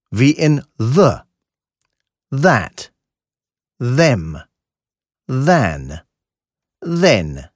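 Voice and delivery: "the, that, them" with tongue between teeth; lisped soft S. Shown as a demonstration. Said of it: "gelispeltes S".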